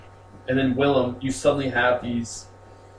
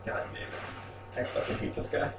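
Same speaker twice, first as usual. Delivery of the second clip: muttered curse; 11 dB quieter, faster than usual. Acted. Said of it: "you're just like".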